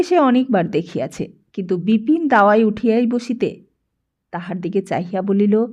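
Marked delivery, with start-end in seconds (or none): none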